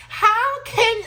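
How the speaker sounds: sudden high, loud heated outburst; getting heated. Real, not acted. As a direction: blurt it out worked up and loud. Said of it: "I'm getting heated".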